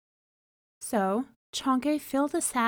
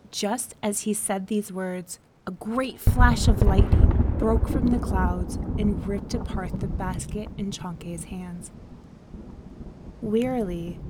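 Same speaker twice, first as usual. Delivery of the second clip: ordinary delivery, nothing marked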